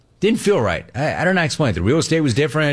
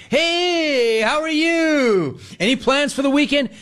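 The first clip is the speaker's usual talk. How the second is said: very high, slow sing-song teller greeting; mock the teller's forced cheer; hammed-up sugary bank teller; chirpy bank teller; 13 semitones higher, slower than usual. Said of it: "they kept doing me like that".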